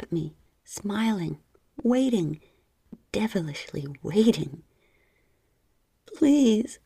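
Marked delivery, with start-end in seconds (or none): 6.1-6.8 high, loud begging please; cried please. Acted. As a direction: plead out loud, desperate. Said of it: "I cried".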